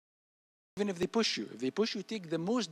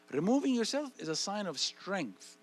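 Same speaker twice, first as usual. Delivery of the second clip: ordinary delivery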